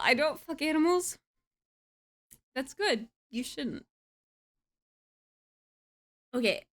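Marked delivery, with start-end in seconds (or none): none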